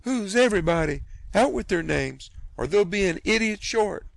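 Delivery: high, loud fierce demand; an aunt about to scratch him; threatening scold. Acted. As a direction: spit out the threat.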